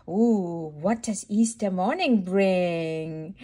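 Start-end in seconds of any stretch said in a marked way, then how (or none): none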